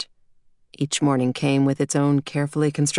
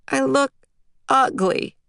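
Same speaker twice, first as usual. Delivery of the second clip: put-on high-pitched, halting childish pout; sulky little girl; 10 semitones higher than usual. A narrator's reading. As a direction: complain to Daddy with a pout. Small girl sulks when dressed.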